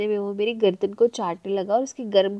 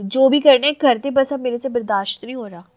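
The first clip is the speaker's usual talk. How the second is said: louder, irritated outburst; mildly angry, louder complaint; a touch angry; 3 semitones higher, 9 dB louder than usual. A narrator's reading. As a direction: snap it out, impatient. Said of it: "मैं ना थोड़ी गुस्से में बोली".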